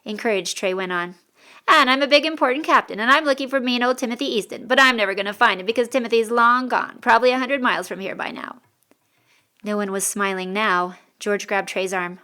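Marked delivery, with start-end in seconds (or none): 1.7-8.6 loud, high mock-important captain; hammed-up send-up of a self-important captain; bad Western impression. A narrator's reading. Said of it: "rather bad attempt at Western speech".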